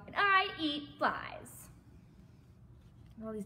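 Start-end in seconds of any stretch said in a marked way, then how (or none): 0.1-1.7 slow, loud boast with swooping pitch; big-mouthed frog announcing himself loudly; shouted. A narrator's reading. Frog introduces himself to another animal, shouted. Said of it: "shouted the wide-mouthed frog".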